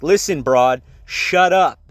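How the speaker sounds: loud, firm telling-off, climbing on the last words; retold angry command. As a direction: act out firmly telling someone to shut up. Retold argument with security guard.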